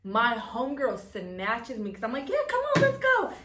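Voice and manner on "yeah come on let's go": high, loud and eager; eager younger self. Retold or imitated. Telling the stranger she'll take the ride, high eager yelp. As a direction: jump at the ride like a naive kid.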